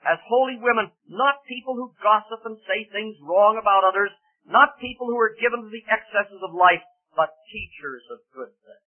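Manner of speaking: high, loud sermon delivery; emphatic preaching. Real, not acted. Preacher exhorting the aged women to holy living, emphatic preaching. Preaches the verse at full force.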